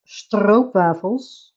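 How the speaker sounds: stroopwafels slow with a trilled Dutch R; rolled R. Shown as a demonstration. Streamer showing chat the Dutch rolling R, slowly. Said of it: "That's a rolling R".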